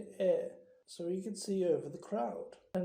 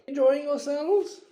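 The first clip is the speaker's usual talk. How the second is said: high, loud jovial greeting; pompous party host; hearty host; 9 semitones higher, 10 dB louder than usual. A narrator's reading. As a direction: call out brightly to guests. Ghost host greets guests.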